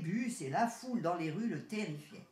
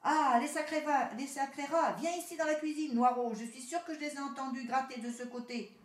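louder, higher furious shouting at the rats; angry yelling; 6 semitones higher, 4 dB louder than usual. Acted. House owner shouting to the cat Noiraud about the rats, angry. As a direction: yell across the house at the pests. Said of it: "des hurlements retentirent".

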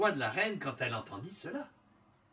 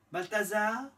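higher, hopeful guess; queen's voice; 8 semitones higher than usual. A narrator's reading. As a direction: ask the name hopefully, higher. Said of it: "son énumération".

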